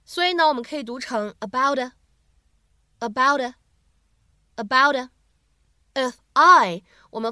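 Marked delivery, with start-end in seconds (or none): 1.4-5.1 闪音 about a; linked flap t. Shown as a demonstration. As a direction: slowly run "about a" together with a flapped t. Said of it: "闪音的处理".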